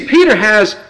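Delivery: ordinary delivery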